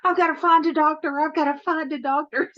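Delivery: high, fast, hammed-up panic; mock freak-out. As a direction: imitate a man frantic for help. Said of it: "and he freaks out".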